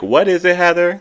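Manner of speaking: high, loud mock Mary prodding Heather. Mary prods Heather for the word, high loud mock prod. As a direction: pitch it up high and push for the answer. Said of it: "Mary was like".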